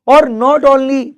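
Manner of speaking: और drawn out high and loud; stress on और. Shown as a demonstration. Teacher booming और to the class. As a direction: hit और big and slow, then go on. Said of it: "teacher stressing point".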